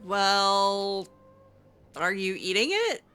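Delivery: ordinary talk, nothing marked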